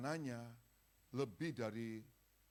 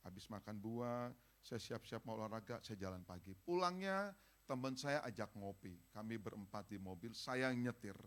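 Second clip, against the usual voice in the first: usual speaking voice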